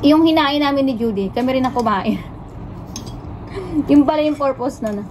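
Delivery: speaking between bites; talking while chewing. Real, not acted. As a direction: food in mouth. Seen 0: Left speaker holds plate, lips working between words, eating throughout.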